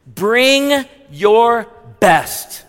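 drawn out, each word held; slow emphatic charge. Shown as a demonstration. Preacher charging the church, drawn-out and emphatic. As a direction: stretch it like a rallying call. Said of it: "slow emphatic exhortation".

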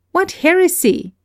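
sudden high exclamation of disbelief; cry of protest. Acted. Narrator protesting Galsworthy's alliance idea, high exclamation. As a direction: throw the word up high in shock. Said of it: "I cried".

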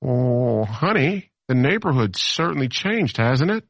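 mock suburban liberal. Liberal looking up from the ivory tower, mock dismay. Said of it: "you go".

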